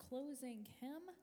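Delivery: ordinary talk, nothing marked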